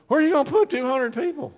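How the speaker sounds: high, loud startled question; retold panicked objection; alarmed question. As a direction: blurt it out in alarm.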